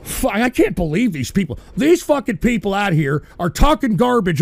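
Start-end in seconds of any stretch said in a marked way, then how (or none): none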